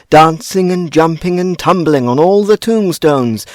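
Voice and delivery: usual speaking voice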